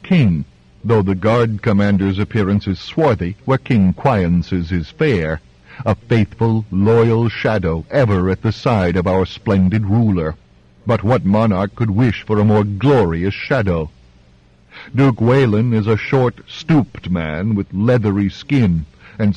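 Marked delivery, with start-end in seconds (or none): none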